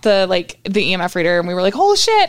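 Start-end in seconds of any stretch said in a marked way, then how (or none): 1.8-2.3 startled yelp. Retold or imitated. Friends scared by the EMF reader.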